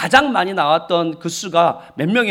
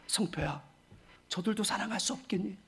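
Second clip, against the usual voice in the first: much softer, near-whispered plea; God's soft voice; 16 dB quieter than usual. Retold or imitated. God asking the pastor to love the villagers, hushed. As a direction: ask it gently, as God pleading. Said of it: "저에게 말씀하는 거예요".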